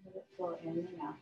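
calm yoga breathing guidance with long pauses; unhurried meditation voice, letting each phrase settle; guided meditation. Real, not acted. Yoga teacher settling students into breath and relaxation, guided meditation.

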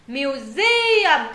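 Museum with the middle syllable punched loud; stress on second syllable. Shown as a demonstration. Teacher showing students where Museum is stressed, second syllable hit loud. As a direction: lean hard on the "se" in Museum. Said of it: "các bạn nhấn mạnh vào âm G".

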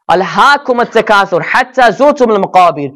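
fast, melodic Quranic recitation. Acted. Preacher reciting the verse to his congregation, melodic recitation. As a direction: chant the verse in tajwid.

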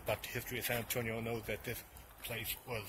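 ordinary talk, nothing marked

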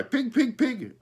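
high, loud crow squawk of pig; a crow cawing pig pig pig, high and loud; crow cry. Acted. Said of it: "fun to cry".

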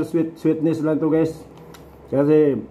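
talking while chewing fruit; muffled through mouthful of papaya; mouth full, chewing. Real, not acted. Seen 2: Bites papaya at -3, lips pursed chewing, slice held at mouth.

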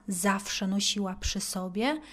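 ordinary delivery

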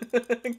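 a sudden high, loud burst of laughter; bright laugh. Acted. Teasing her patient, she bursts out laughing. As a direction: laugh out, caught off guard.

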